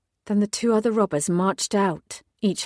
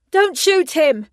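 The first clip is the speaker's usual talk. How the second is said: much higher, loud shout to stop a gunman; yelled; 10 semitones higher, 8 dB louder, slower than usual. Acted. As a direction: yell it out to save him. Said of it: "I yelled".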